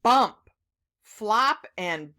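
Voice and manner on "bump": loud punched sound word; loud storybook bump. A narrator's reading. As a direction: say it with a thump.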